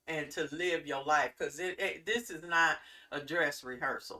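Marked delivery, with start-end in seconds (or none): none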